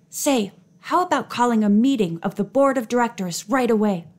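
ordinary delivery

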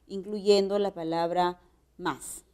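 ordinary talk

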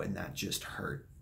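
slow, soft, trailing to breath; quietly aching. Real, not acted. Recalling letting his ex go, soft and breathy. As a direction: let the pain drain the voice.

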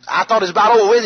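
ordinary delivery, nothing marked

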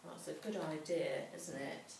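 ordinary delivery, nothing marked

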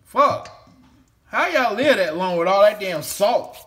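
loud, high rant about the salt; exasperated outburst. Real, not acted. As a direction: blow up at all that salt. Ranting about Louisiana salt.

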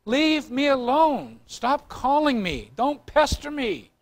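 slow, drawn-out get-lost; a pastor slowly shooing off callers; slow annoyed brush-off. Retold or imitated. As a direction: spell out each command slowly, fed up. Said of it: "I'd say".

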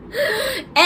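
high laugh with a crying edge; teary laugh. Real, not acted. Laughing about being made to cry, teary high laugh. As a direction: laugh through the tears.

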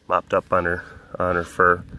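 ordinary talk

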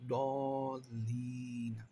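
slow Quranic chant; drawn-out recitation. Shown as a demonstration. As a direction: stretch the word as in recitation. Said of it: "এটারে দাল পড়া যাবে না". (ধীর তিলাওয়াতের সুর)

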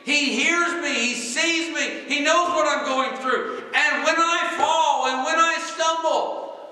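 high, loud preaching at full force. Real, not acted. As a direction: cry it out from the pulpit, stretched and high.

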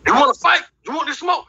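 hamming up the naked guy picking a fight; mock challenge. Comic retelling, naked classmate daring him to fight. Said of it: "got butt ass naked".

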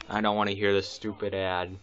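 usual speaking voice

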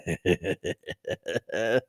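laughter tailing down low; real laughter. Real, not acted. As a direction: keep chuckling helplessly. Reader keeps laughing.

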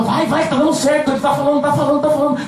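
high, gleeful mock devil voice; gleeful devil egging on. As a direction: cheer on like a delighted demon. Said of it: "E aí você começa". (diabo comemorando, voz aguda e debochada)

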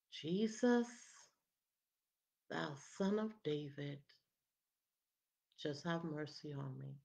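soft, low plea for mercy; subdued cry out. Acted. Blind man begins calling to Jesus, a subdued cry. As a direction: cry out weakly, holding the volume back. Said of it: "He begins to cry out".

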